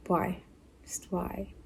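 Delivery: breathless whisper of disbelief; whispered why. Real, not acted. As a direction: whisper it in exasperation. Exasperated aside.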